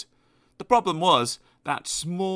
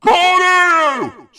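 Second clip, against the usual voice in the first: much higher, very loud cornflakes; shouted repeat; 11 dB louder than usual. Acted. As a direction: yell it back mockingly. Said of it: "shouted the grocer".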